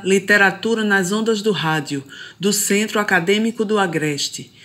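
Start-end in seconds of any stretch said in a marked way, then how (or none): none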